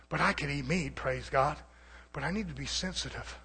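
quiet. Real, not acted. Drops after shouting.

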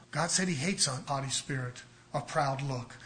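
normal talking voice

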